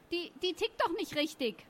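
high-pitched, stammered complaint; acted affronted boy, pitched well up; rattled indignation. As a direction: protest after taking a beating. Beaten boy complains after fight.

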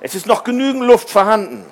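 quick, high and upbeat; delighted. A narrator's reading. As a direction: report good news happily. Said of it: "freute sich Tom".

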